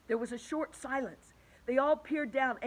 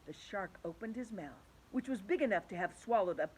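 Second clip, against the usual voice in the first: ordinary delivery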